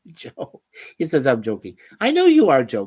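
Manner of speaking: usual speaking voice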